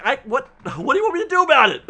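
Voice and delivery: high, fast, incredulous comeback; exasperated retort. Retold or imitated. Vlogger mimicking his own reply to doubters, incredulous and fast. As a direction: throw your hands up in disbelief. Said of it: "And I'm like".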